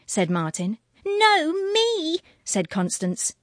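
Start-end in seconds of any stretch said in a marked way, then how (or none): 1.0-2.2 put-on high, loud child's claim; insistent little girl. A narrator's reading. Girl claiming front seat from brother, high insistent child. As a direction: chirp it like a girl grabbing her turn.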